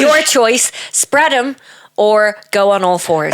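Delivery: high, loud, hammed-up order; mock bossy lad. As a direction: bark it out like a cocky lad. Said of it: "acting the lad, teasing".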